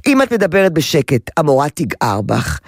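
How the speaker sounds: normal talking voice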